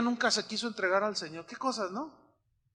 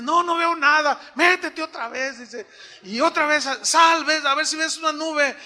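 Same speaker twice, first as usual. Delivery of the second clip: urgent retold voices; 8 semitones higher, 6 dB louder than usual. Retold rain prayer dialogue.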